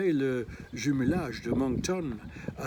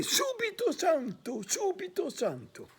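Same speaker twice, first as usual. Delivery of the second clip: much higher, mock shouted Italian cry; Fabrizio yelling. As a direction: hurl the Italian words up high. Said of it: "Fabrizio hurlait". (cri aigu et théâtral en italien)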